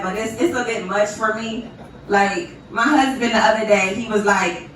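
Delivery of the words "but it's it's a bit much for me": ordinary talk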